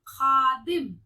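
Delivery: loud, drawn-out खादिम with throaty kh; emphatic model खादिम, fricative kh; nukta pronunciation. Shown as a demonstration. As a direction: scrape the kh in खादिम. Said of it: "नुक्ते का उच्चारण आना चाहिए".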